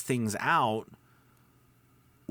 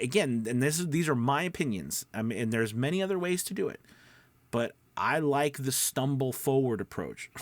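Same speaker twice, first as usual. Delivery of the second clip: ordinary talk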